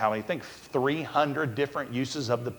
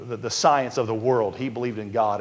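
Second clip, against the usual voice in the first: ordinary talk, nothing marked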